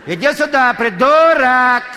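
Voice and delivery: высокий, громкий окрик другу через стадион; орать что есть силы, зовя друга; крик через всё поле. Retold or imitated. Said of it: "он кричал". (истошно кричит через всё поле, растягивая «придурок»)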